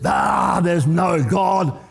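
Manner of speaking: slow, jeering shout over the fence; a miser gloating that there's no God; scoffing shout. Retold or imitated. Grumpy neighbour shouting over the fence at the widow, slow scoffing jeer. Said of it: "and says".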